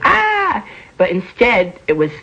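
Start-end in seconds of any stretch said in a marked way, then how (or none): 0.0-0.6 high, held imitation scream; imagined crowd scream. Imagining people's expressive reaction at City Hall, screaming. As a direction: imitate a wailing crowd in one high cry. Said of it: "I thought people would be going".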